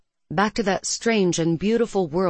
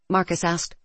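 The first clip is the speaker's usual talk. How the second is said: ordinary delivery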